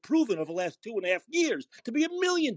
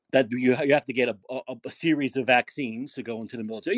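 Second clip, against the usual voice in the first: much lower, toned-down restating; low recited excuse; 9 semitones lower than usual. Retold or imitated. Host restating Kirby's military excuse, low-voiced. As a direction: recite the opponent's line down low.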